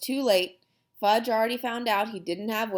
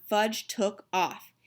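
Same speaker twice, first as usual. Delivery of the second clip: ordinary delivery, nothing marked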